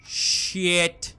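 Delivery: loud, high startled shout; startled yell. Real, not acted. Gamer caught by a jump scare, startled loud yell. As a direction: jolt out the words at a jump scare.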